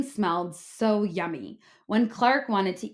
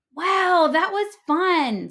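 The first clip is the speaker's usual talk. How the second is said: breathless; 8 semitones higher, 6 dB louder, slower than usual. A narrator's reading. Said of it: "said the new kid breathlessly".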